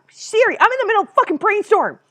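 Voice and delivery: high, loud outburst at Siri; exasperated scolding. Real, not acted. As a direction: yell at the interrupting phone assistant. Scolds phone assistant for interrupting.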